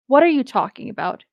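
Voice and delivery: ordinary delivery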